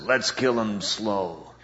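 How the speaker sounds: low, slow, soft menacing threat; slow menacing biker. Retold or imitated. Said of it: "then one says".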